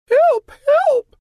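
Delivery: very high, loud cry for help; princess crying help. Acted. Little princess crying for help at the door. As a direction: scream it like a frightened little girl. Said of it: "the little princess cried".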